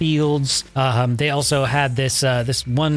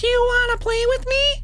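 put-on high, eerie little child; sing-song like a spooky toy; creepy small child voice. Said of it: "It sounds like a small child".

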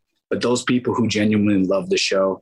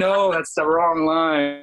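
high, drawn-out slow objection; retold exasperated correction; mock correction; 7 semitones higher, much slower than usual. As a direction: call out the mistake in an acted voice. Colleagues correcting his missed line.

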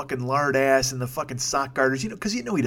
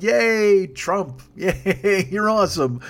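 mock cheering fan; 6 semitones higher, 5 dB louder, much slower than usual. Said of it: "people who are like".

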